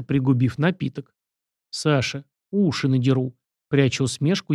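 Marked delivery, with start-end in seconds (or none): none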